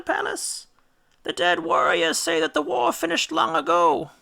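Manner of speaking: high, wailing mother's voice; ghost mother. A narrator's reading. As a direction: fret for her son's home.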